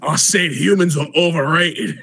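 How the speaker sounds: hammed-up Australian insisting; gruff Australian accent. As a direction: repeat it firmly like a stubborn Australian. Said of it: "in a gruff Australian accent".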